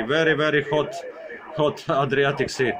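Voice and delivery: ordinary delivery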